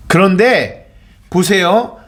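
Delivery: ordinary talk, nothing marked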